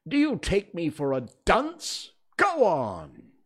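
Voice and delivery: loud, indignant royal challenge; sharp. A narrator's reading. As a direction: demand sharply that he carry on. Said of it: "said the king sharply".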